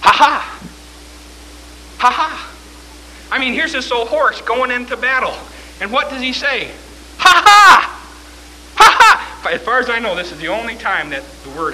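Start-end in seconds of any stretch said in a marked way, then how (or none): 0.0-2.5 short, higher-pitched mock laugh; two separate defiant ha-ha bursts; horse laugh. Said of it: "it's a horse laugh". 7.2-9.5 high, loud mock laugh of a war horse; booming, defiant ha-ha. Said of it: "it's a horse laugh".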